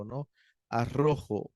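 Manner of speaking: ordinary talk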